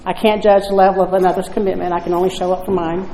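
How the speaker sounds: imitating the sponsor's calm voice. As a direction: answer gently, unbothered. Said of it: "very calmly say".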